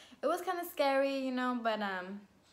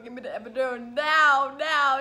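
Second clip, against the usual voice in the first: imitated labor-pain insistence, getting louder; desperate demand; 8 dB louder than usual. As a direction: demand building to a loud yell. Retold desperate demand during contractions.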